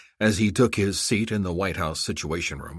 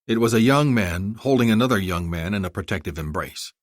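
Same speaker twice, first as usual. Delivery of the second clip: ordinary delivery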